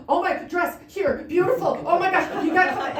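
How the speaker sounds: high, loud, breathless gushing; excited gushing. Retold or imitated. Owner texting brides their dress arrived, high excited gush. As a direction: squeal the news like you can't contain it. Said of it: "I'll be so excited when it comes in".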